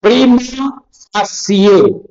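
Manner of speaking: high, spaced "Prima facie", pree-ma fa-si-ye; careful Latin pronunciation. Shown as a demonstration. Teacher fixing a term often mispronounced, careful pronunciation. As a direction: separate "Prima" and "facie", ending in si-ye. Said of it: "ഈ pronunciation കൂടി ഇരുന്നോട്ടെ".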